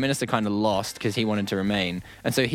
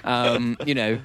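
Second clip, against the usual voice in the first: posh British prime minister; 3 semitones higher than usual. Pretend. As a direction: play a fussy posh British politician, 'jolly good' and 'cripes'. Mocking the new prime minister.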